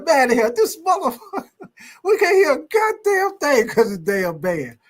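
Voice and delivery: high, laughing mock complaint; imitating a coach laughing through his complaint; frustrated coach. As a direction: grumble like a coach drowned out by the band. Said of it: "And coach be down there".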